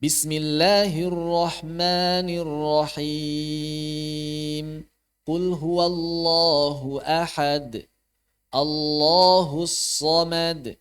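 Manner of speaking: slow, sustained recitation with "ahad" lightly bounced; qalqalah ringan. Shown as a demonstration. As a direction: keep the final d of "ahad" and "samad" light. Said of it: "Jadi ringan saja qalqalah itu, nggak usah kuat-kuat". (tilawah pelan, qalqalah "ahad" dan "samad" ringan saja)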